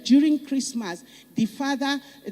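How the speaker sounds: ordinary talk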